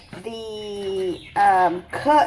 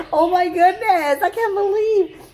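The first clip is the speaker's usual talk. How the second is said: high, laughing oh my goodness; laughing disbelief; 9 semitones higher, 4 dB louder than usual. Real, not acted. Cook laughs at her own onion tears, high and giddy. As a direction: squeak it out through a laugh.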